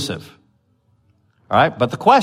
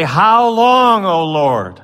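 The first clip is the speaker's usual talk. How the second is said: high, louder plea; crying out; 6 semitones higher, 4 dB louder, much slower than usual. Retold or imitated. The slain crying to God, high and loud. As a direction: call it up to the Lord. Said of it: "And they say".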